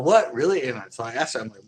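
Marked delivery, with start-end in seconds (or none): 0.0-0.6 surprised disbelief. Retold or imitated. Dispatcher says truck was towed.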